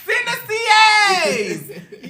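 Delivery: high, slow shout broken with laughter; laughing hollered plea to the chat. Real, not acted. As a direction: cackle out the request.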